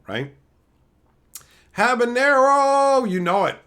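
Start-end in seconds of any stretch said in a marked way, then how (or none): 1.8-3.6 hammed-up shout. Hamming up a chat name.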